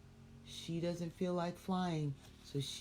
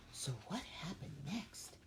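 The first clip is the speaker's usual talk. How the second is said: soft whisper of suspense; suspenseful whisper; 11 dB quieter than usual. Acted. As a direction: lean in and whisper to the kids. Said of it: "storyteller asks in whisper".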